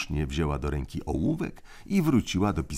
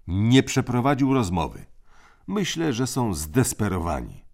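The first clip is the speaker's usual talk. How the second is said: put-on raised, commanding boy's voice; crisp orders from the eldest; bossy older brother; 7 semitones higher, 5 dB louder than usual. A narrator's reading. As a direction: lay down the rules like a little tyrant. Tim reporting news.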